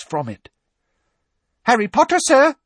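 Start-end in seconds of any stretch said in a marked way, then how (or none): 1.6-2.6 very high, excited elf squeak; the elf wakes Harry with a squeal; squeaky house elf. Acted. Dobby calling out to the sleeping Harry, high and excited.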